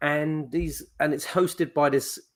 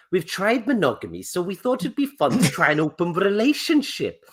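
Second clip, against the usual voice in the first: a light mock Welsh accent, a little higher; lightly done Welsh accent of a girl; 4 semitones higher than usual. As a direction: imitate a young Welsh girl, breezy. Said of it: "Welsh girl, and she's like".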